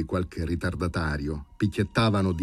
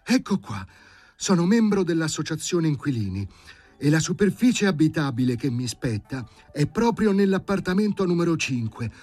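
higher, pompous claim of rights; smug Sharikov; 11 semitones higher, 3 dB louder than usual. Acted. Sharikov showing his papers to the doctors, higher and smug. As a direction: read out his papers triumphantly. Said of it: "e indicandoli con le dita disse".

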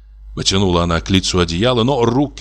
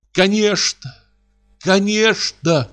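much higher, drawn-out hysterical cry; 12 semitones higher, slower than usual. Acted. Sobbing wife crying out at her husband, much higher hysterical cry. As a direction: cry it out, almost beside herself. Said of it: "кричала она".

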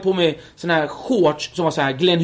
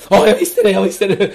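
knowing mother; 3 semitones higher, 5 dB louder than usual. Retold or imitated. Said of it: "Kurdiska morsor drömmer alltid".

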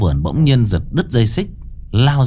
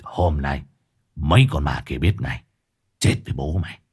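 low, menacing shaman voice; gruff Cậu Tẩy voice; 8 semitones lower, 4 dB quieter than usual. Acted. Exorcist boasting to the ghosts, low and menacing. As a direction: growl it like a cocky exorcist.

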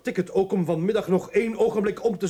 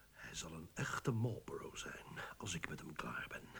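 hushed, much softer whisper; whispered vow; 11 dB quieter than usual. Acted. Father vowing to himself about his son, whispered. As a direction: swear it under your breath.

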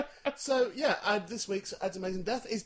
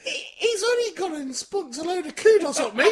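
much higher, hammed-up outraged squeal; joking disbelief, high and a bit louder; mock shocked voice; 13 semitones higher, 7 dB louder than usual. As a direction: play a scandalised friend, pitch way up. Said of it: "you'll be going".

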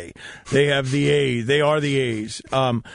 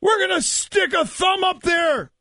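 Redmond yelling. Retold or imitated. Backup catcher Redmond yelling a crude heckle, shouting. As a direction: shout it out over the noise. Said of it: "Redmond yells in the middle of it".

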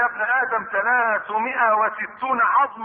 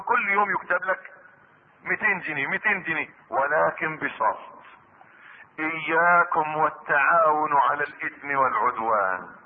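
ordinary talk

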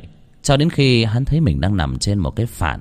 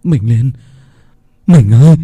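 louder, rising tearful call; tearful cry; 2 semitones higher, 8 dB louder than usual. Acted. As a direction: cry out to her through tears. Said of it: "trào nước mắt". (gọi vợ trong nước mắt, to dần)

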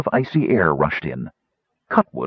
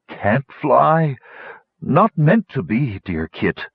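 slightly raised, labored dragon voice; resigned dragon; 4 semitones higher, slower than usual. A narrator's reading. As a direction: give up in short drawn-out phrases, dropping at the end. Failing dragon resigned to falling.

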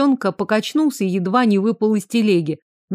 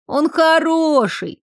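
put-on high, thin, slow child's plea; small boy defending his uncle; thin little voice; 7 semitones higher, slower than usual. A narrator's reading. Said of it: "Тоненький голосок Альера звучал тихо".

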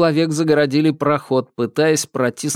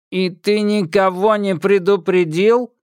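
metallic patrolman; 7 semitones higher, 3 dB louder than usual. Acted. Patrolman accuses Thomas.

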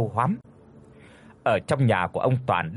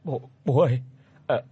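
frightened telling; 5 semitones higher, faster than usual. A narrator's reading. Daughter tells father, still scared.

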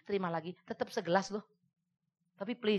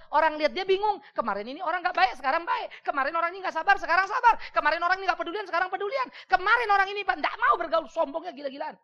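full-force preaching; 8 semitones higher, 8 dB louder than usual. Acted. Khotbah dengan semangat penuh.

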